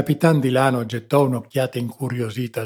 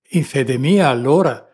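louder, impulsive outburst; captain's sudden exclamation, louder; 3 semitones higher, 8 dB louder than usual. A narrator's reading. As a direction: blurt it out on impulse. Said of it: "esclamò il capitano d'impulso".

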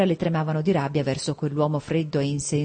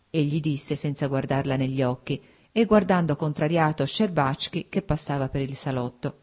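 plain reading voice

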